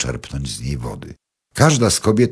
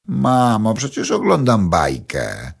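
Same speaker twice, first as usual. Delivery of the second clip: high whining reply, drawn out slowly; a girl protesting at being interrupted; whiny protest; 7 semitones higher, 3 dB louder, slower than usual. A narrator's reading. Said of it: "odpowiedziała Paulina". (Paulina marudzi do mamy, wolno i wyżej)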